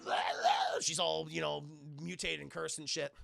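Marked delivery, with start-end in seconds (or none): 0.1-0.8 mock monster growls. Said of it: "Sam's all like".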